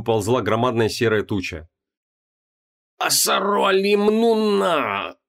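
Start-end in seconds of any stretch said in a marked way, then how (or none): 3.0-5.2 higher, slow cracking cry; voice breaking. Acted. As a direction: let the voice crack under strain. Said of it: "срывающимся голосом произнёс Креол".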